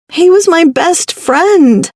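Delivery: high and slow, near tears; crying. A narrator's reading. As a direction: cry it out, voice breaking. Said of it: "I cried".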